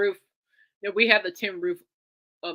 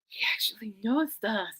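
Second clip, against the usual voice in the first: breathless, awed whisper; a giddy hushed whisper to her friend; excited whisper; 4 dB quieter than usual. Retold or imitated. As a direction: whisper it to your friend like a secret thrill. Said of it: "she kinda whispered to me going".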